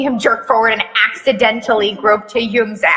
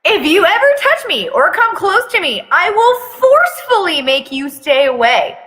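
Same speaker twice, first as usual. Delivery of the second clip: threatening; 7 semitones higher than usual. Acted. Taehyung threatening, high and loud.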